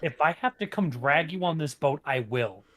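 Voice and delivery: ordinary delivery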